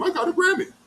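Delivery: high mocking brag, imitating a show-off; mocking boast. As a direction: hold the boast up high to mock it. Mocking Patrick's bragging.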